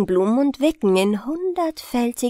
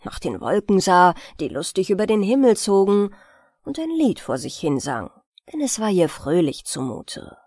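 plain reading voice

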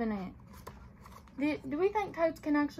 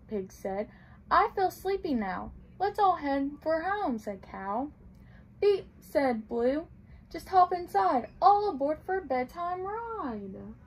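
gentle storybook read-aloud; reading to children; slower than usual. A narrator's reading. Librarian reading a picture book to children, gently.

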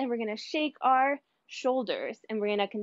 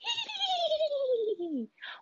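high cackling hyena giggle; hyena laugh; 4 dB quieter than usual. Shown as a demonstration. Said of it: "your best hyena laugh".

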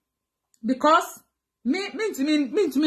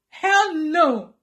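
raised, forceful refusal; emphatic denial; 2 semitones higher than usual. Real, not acted. Vlogger insisting she does not miss her ex, raised and emphatic.

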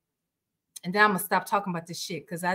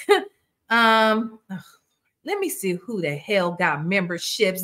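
usual speaking voice

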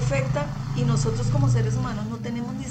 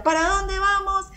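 higher, slowed preacher's call to the crowd; rallying call; 9 semitones higher, 6 dB louder, slower than usual. Retold or imitated. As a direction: call it out like a preacher rousing the room. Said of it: "todo el mundo tenía que gritarle". (llamada alta y lenta de predicador a la multitud)

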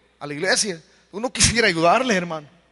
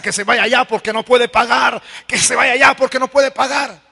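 insistent shout building louder and higher; demanding lender; 4 semitones higher, 4 dB louder than usual. Acted. Lender demands the debtor leave her house, shouting. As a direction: order her out, louder on the repeat.

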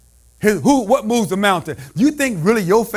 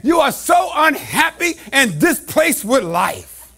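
normal talking voice

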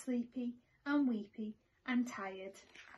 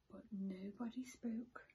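soft whisper; 10 dB quieter than usual. A narrator's reading. Narrator whispers that nobody spoke. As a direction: whisper the silence. Quiet yard at dawn.

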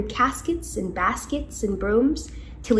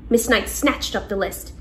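ordinary delivery, nothing marked

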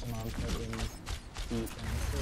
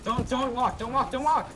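urgent warning; 10 semitones higher, 6 dB louder than usual. Real, not acted. Warning teammate mid-fight.

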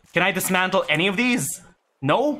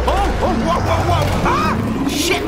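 higher, louder rising panic; panicked alarm; 7 semitones higher, 4 dB louder than usual. Real, not acted. Player panicking as things slip out of control. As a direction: yelp as it goes wrong.

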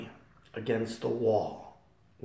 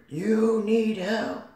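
slow, much higher, louder call; booming voice; 12 semitones higher, 6 dB louder, much slower than usual. Retold or imitated. Divine voice repeating to the sinner, booming. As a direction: boom it like a divine voice. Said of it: "that voice, I kept hearing it".

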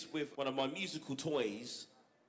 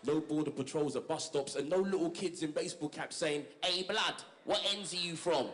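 rhythmic, steady performance-poet delivery; spoken word poem; 4 semitones higher than usual. Acted. Poet reciting his carnival poem to an audience, rhythmic spoken word. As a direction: perform it like a live poem to the crowd.

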